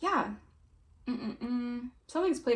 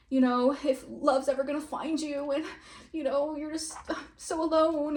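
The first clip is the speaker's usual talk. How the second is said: higher, slow teasing sing-song, laughing at the end; mocking tone; 5 semitones higher, slower than usual. Teasing the querent's rival about love never coming. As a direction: poke fun at the idea of being alone. Mocking the doubter's lonely view.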